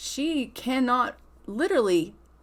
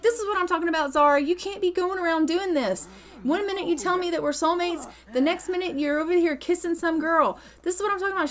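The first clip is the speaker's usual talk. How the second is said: higher-pitched scolding, hurt and angry; angry Marisol; 6 semitones higher, faster than usual. Retold or imitated. Marisol berating Zara for kissing Lorelai, high angry scold. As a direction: chew her out for kissing another girl. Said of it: "Marisol is pissed".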